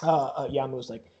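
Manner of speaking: ordinary talk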